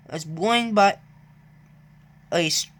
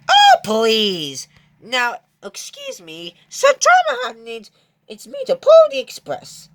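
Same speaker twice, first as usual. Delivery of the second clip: haughty scoff; 6 semitones higher, 6 dB louder than usual. Acted. James scoffs and leaves.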